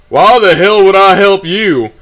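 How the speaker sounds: loud, high, drawn-out scornful question; callous dismissal. A narrator's reading. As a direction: slowly throw the plea back in contempt. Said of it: "His callous dismissal".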